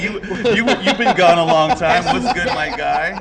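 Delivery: ordinary talk, nothing marked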